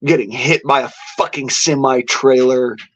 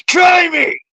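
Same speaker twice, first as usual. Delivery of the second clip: rising taunt, pitch climbing up to a near yell; defiant taunt; 9 semitones higher than usual. Retold or imitated. To the Reaper at death's door, a climbing defiant dare. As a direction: dare Death to take him, building higher. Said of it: "said, "Come on".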